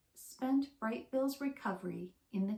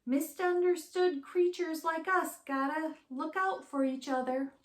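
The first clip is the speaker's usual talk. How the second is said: higher, louder chirpy skunk introducing herself; bright little critter, pleased to meet you; a cheerful skunk greeting her famous guests; friendly skunk voice; 7 semitones higher, 5 dB louder than usual. Acted.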